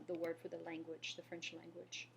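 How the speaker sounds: ordinary talk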